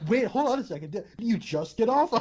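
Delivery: high, incredulous movie wisecracker; Ryan Reynolds. Acted. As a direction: stop everything in mock disbelief. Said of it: "you would have Ryan Reynolds as Jack".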